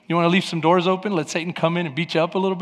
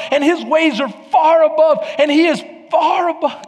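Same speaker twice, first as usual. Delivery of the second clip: raised, emphatic pulpit delivery; full-force preaching; 7 semitones higher, 3 dB louder than usual. Real, not acted. Preacher to congregation on God being far above, full-force preaching. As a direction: preach it insistently, pitch climbing high.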